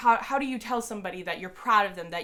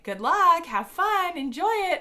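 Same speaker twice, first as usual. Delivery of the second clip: high, bright cheering, louder; cheery staff send-off; 10 semitones higher, 5 dB louder, slower than usual. Retold or imitated. Staff members cheering on fans, high and excited. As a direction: sing it out like upbeat staff waving fans through.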